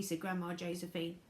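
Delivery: plain reading voice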